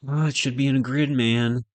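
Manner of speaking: loud, high exasperated cry at the screen; frustrated outburst. Real, not acted. Coder yelling at a layout that won't become a grid, exasperated. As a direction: vent the frustration loudly. Frustrated at code not working.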